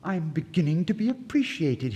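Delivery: high, loud, overjoyed; delighted cry. Acted. Poor student crying out over the sapphire. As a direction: burst out with glad surprise. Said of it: "he cried".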